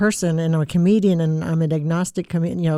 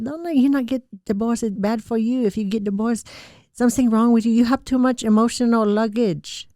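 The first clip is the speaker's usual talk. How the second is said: put-on higher-pitched Thai-accented scolding mother; Thai mom impression; 6 semitones higher than usual. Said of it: "And she said".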